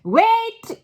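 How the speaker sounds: high piercing witch shriek. A narrator's reading. Witch stops the whole room with a shriek. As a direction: shriek it across the ballroom. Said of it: "shrieked one of the witches".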